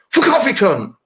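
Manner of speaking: high, loud blurted yell; shouted insult. Retold or imitated. To the ticket lady during a fit, a high loud shout.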